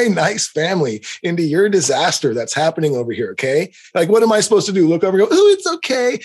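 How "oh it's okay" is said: much higher sarcastic sing-song; high mock reassurance; mocking parent. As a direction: parody the pushover parent.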